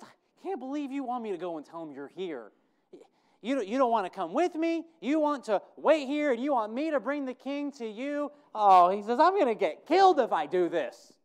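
loud high protest building to a near shout; acted alarm. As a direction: cry out that you'll be killed. Preacher voices Obadiah's fear.

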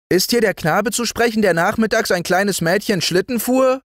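put-on high, urgent young boy demanding; heated boy's voice. Boy at the door demanding the culprit, high and heated. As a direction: burst in like an eager boy at the door. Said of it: "fragte heftig eine helle Knabenstimme".

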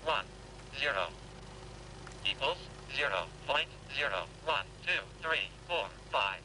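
synthetic calculator voice; robotic counting numbers; talking calculator. Acted. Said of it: "ein sprechender Taschenrechner".

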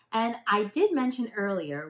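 plain reading voice

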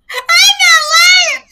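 very high, loud, drawn-out squeal; shrieked insistence. Real, not acted. Storyteller swearing her friend really prayed so, shrieked insistence. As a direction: squeal it in helpless hilarity. Laughing, insisting the tale is true.

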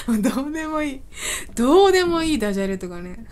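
amused words broken by giggles; real giggly, self-mocking talk; laughing. Real, not acted. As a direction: laugh through it at your own silly puns. Laughs at her own puns.

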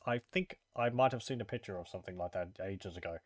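muffled, speaking into a cup; talking into a cup. Real, not acted. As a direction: voice muffled by a mug at the mouth. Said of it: "I was speaking into my cup".

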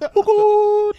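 very high squeaky Caribbean "Cuckoo"; mocking mother's accent. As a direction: squeak it like mum in a Caribbean lilt. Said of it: "make fun of my mother accent".

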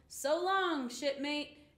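high, loud goodbye; calling out. A narrator's reading. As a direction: call back while paddling away. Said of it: "cried Pete".

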